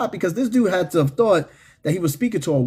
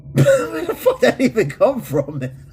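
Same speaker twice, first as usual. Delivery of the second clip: high, slow, laughing disbelief; real laughter; 9 semitones higher than usual. Real, not acted. Streamer asking where the remark came from, laughing. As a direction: ask it while breaking up laughing.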